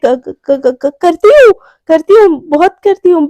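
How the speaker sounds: stammer, then a loud blurt; flustered admission. Retold or imitated. Garima admitting she cares for Priyanka, flustered and loud. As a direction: stutter awkwardly into an insistent reply. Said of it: "awkward होता हुआ देख के".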